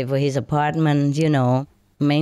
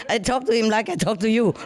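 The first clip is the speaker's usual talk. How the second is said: laughing; 6 semitones higher than usual. Real, not acted. Laughing about losing her temper.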